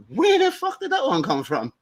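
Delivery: amused surprise. Real, not acted. Surprised at own line.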